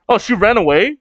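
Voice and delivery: ordinary delivery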